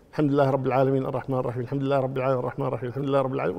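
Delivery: fast, low, rote recitation; quick repetition. Acted. Teacher acting a new convert repeating known verses, fast low recitation. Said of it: "كررها".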